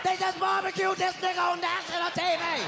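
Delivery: high shouted outrage; outraged shout. Real, not acted. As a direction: shout it in disbelief. Outraged punchline.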